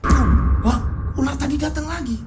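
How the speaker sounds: high disbelieving husband; can't believe it came back; surprised, pitched high; shocked husband. Acted. Husband shocked snake returned.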